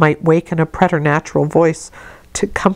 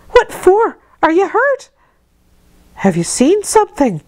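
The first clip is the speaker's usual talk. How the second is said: much higher, urgent questions; Bessie demanding; 13 semitones higher than usual. A narrator's reading. Maid questioning the frightened child, urgent and high. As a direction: press the child for an answer. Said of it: "again demanded Bessie".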